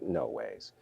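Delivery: low, quiet no-way; a low, soft, stunned murmur; stunned disbelief. Retold or imitated. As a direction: hush it out in disbelief. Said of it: "I'm like".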